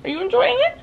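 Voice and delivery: tiny high-pitched coo to a pet; pet talk. Real, not acted. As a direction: talk to the cat in a very high little voice. Cooing to the pet at home.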